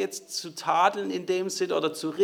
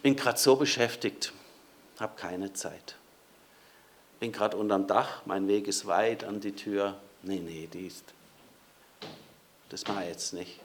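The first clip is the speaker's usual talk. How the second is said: muttered, dismissive brush-off fading softer; reluctant excuses; 6 semitones lower, 4 dB quieter than usual. Acted. Preacher playing a busy person refusing to open, soft grumbling. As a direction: wave the caller off half under your breath.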